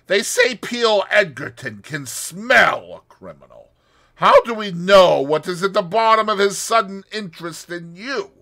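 loud, high exasperated rant; Russian man ranting in despair; despairing outburst. A narrator's reading. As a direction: throw up your hands in despair. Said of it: "threw up his hands in despair".

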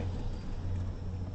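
real low throat-clearing; a quick ahem. Real, not acted. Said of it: "I was clearing my throat".

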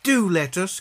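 put-on high children's voices, excited; children jumping at the idea; eager cry. A narrator's reading. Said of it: "cried the children".

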